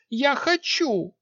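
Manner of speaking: put-on very high, slow eager girl; determined young queen. A narrator's reading. Queen decides.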